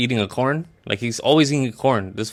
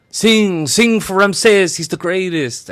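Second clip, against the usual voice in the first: high, loud, drawn-out mock order; acted shouted command; 9 semitones higher, 5 dB louder, much slower than usual. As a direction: call it out grandly in a raised voice. Don Ramon demanding a song.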